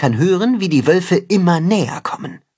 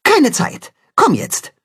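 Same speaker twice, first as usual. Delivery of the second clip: terse, higher urgent answer; curt reply; 11 semitones higher than usual. A narrator's reading. Cat-hen rushing Tacho away, curt and urgent. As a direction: clip it short, no time to lose. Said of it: "antwortet knapp".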